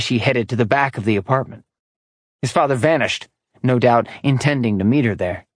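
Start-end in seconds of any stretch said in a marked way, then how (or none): none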